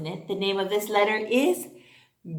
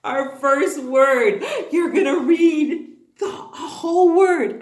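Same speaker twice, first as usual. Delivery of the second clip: excited announcement; 6 semitones higher, 8 dB louder than usual. Acted. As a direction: build the thrill of a first word. Excited build-up for kids.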